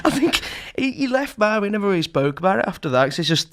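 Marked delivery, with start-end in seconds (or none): none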